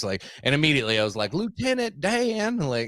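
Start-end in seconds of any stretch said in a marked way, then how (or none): 1.3-2.6 high, drawn-out mocking call; mock movie quote. Joker quoting a movie at legless men on scooters, high mocking call. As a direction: stretch it out high, like a film catchphrase.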